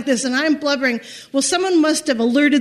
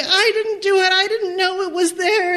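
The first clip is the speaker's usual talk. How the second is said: higher, tearful pleading denial; a retold teary protest, pitched up with a cry; 7 semitones higher than usual. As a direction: plead innocence through tears. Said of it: "now I really fall apart".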